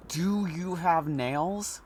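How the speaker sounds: very slow, drawn "Do you have nails?" rising; slow repeat. Acted. Man behind the door slowly repeating his odd question to the doctor.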